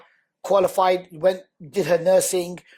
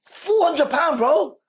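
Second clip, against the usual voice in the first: indignant; 9 semitones higher, 4 dB louder, much slower than usual. Real, not acted. Outrage at the small debt.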